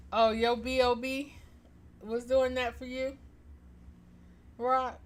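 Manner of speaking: teasing through laughter; giggly mock surprise; laughing. Real, not acted. Teasing aside while laughing.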